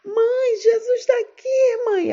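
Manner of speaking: put-on high child's voice on the edge of crying; crying little girl insisting to her mother; tearful child. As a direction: plead with mum that Jesus is here. Child insisting, near tears.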